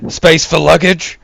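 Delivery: loud and high, calling it across the cab; shouted question. Acted. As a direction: bellow the question to the driver. Shouting over the cab noise.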